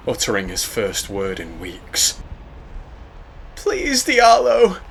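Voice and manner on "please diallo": pleading. Acted. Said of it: "Emmy requested".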